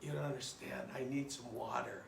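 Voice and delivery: soft, feeble parched man; dying of thirst. Acted. Thirsty desert traveler begs tie sellers for water, soft and weak. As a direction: plead weakly, as if parched. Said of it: "He's dying of thirst".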